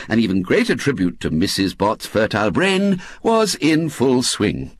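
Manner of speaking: ordinary delivery, nothing marked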